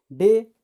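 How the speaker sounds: डे with a retroflex ड, stressed; hard d sound. Shown as a demonstration. Teacher demonstrates the d sound in डे, firm. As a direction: give डे a firm ड, tongue curled back. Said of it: "डे means दिन".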